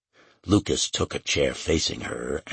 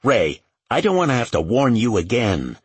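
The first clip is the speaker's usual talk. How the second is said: raised, irritated warning; snapped; 6 semitones higher, 5 dB louder than usual. A narrator's reading. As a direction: snap a louder, higher reprimand. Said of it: "Lucas snapped".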